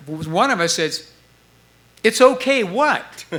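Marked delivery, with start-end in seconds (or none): 2.0-3.3 high, slow, louder mock voice; demanding more. Bandmate demanding the rest from Stu, high and slow.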